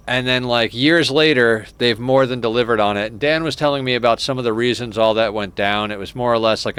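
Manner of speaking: normal talking voice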